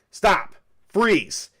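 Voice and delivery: loud high shout of command; police shout. Acted. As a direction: bark the order at the creature. Cop draws gun on creature.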